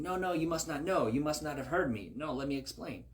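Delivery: high, hurried excuse-making; protesting denial. Retold or imitated. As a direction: talk over him, eager to explain. Saul paraphrased insisting again.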